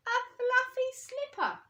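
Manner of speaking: put-on spring the surprise on the children; surprise reveal. A narrator's reading.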